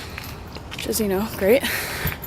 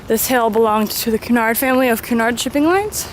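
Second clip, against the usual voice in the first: ordinary talk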